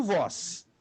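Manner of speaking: ordinary talk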